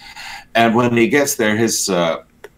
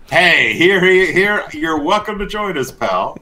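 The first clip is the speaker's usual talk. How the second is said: high, loud call to a new pal; mock eager welcome; 10 semitones higher, 4 dB louder than usual. Patriarch beckoning an ally to join him, mock eager welcome. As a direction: hail them over in a raised, hammed-up voice.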